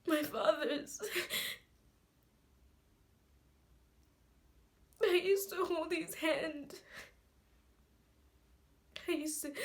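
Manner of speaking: high, loud voice breaking into sobs; tearful grief. Acted. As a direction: choke the words out between sobbing breaths, pitched high. Grieving monologue about dead father's grave.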